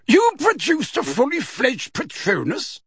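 a stern judge demanding an answer; booming Madam Bones. A narrator's reading. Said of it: "cut across him in a booming voice".

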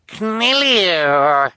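put-on high, loud, drawn-out baby shriek. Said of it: "Sunny shrieked".

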